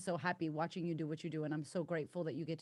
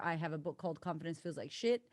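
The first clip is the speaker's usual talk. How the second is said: ordinary delivery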